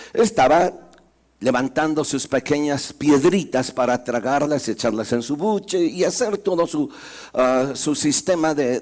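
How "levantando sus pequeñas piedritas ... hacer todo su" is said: ordinary delivery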